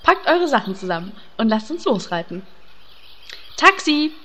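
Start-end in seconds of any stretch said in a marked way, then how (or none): 3.6-4.1 raised hailing call. Acted. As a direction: hail the cab across the street. Hailing a taxi.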